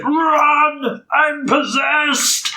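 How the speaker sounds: high, drawn-out possessed cry; exaggerated possessed warning, stretched out; possessed man. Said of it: "being possessed. He's like".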